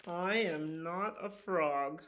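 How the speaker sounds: slow, firm little dragon insisting; a dragon standing his ground, mildly voiced.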